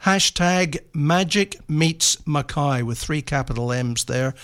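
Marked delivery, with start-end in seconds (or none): 0.0-2.8 slow, word-by-word hashtag; measured, with each word set apart; said slowly. Shown as a demonstration. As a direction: spell it out slowly for listeners to note down. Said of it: "I'll say it slowly".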